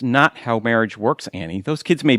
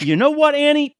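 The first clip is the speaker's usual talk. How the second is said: sarcastic outburst; 13 semitones higher, 4 dB louder than usual. Acted.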